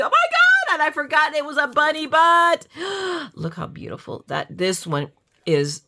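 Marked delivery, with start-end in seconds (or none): 0.0-2.7 very high, loud squeal of discovery; excited squeal. Real, not acted. Sudden delight at forgotten bunny die. 2.7-3.3 high, soft intake of breath; delighted gasp. Real, not acted. Delight at the bunny die.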